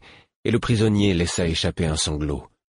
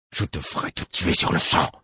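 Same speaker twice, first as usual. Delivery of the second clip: growled threat. A narrator's reading. Narrator growls at the archer he holds by the throat. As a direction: growl it through the teeth. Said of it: "grondai-je".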